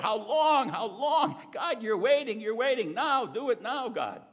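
high, loud impatient outcry; David crying out in the Psalms, high and loud; crying out to God. Acted. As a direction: demand an answer from God. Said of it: "He cries out".

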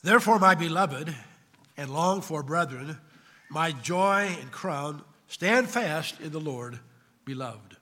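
slow, measured Bible reading; Scripture reading. Acted. Preacher reading Philippians to the church, slow solemn proclamation. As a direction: proclaim it slowly, phrase by phrase. Reads Scripture aloud to congregation.

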